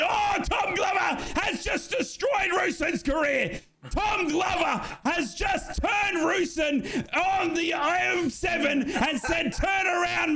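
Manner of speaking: shouted high-pitched goal commentary, slowing on big moments; live play-by-play. Acted. Fan commentator calling the goal to viewers, frantic shouted play-by-play. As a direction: scream the call as the ball bounces around the box.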